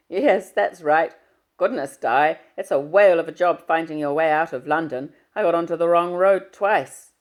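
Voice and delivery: booming fatherly hello down the line. Acted.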